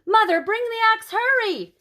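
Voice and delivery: high, loud urgent cry to mother; high, loud breathless call for the axe. A narrator's reading. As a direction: shout down to mother in a hurry. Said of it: "she said".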